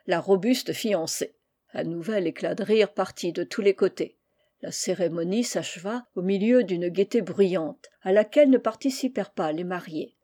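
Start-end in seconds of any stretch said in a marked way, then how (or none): none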